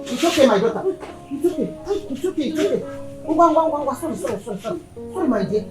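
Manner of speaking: soft, low comforting murmur; soothing. Acted. Parent comforting a crying daughter, soothing.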